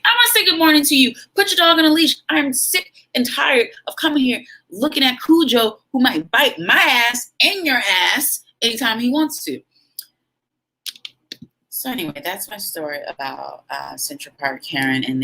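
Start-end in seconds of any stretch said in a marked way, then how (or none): 0.0-9.6 furious rant. Retold or imitated. Mother telling off dog owners about the leash, furious rant. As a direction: let the anger spill out fast.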